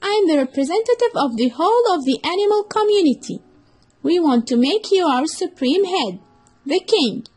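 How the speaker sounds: high, fast and eager fox voice; flattering fox. Acted. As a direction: sweet-talk the elephant into a trap. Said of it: "said the fox".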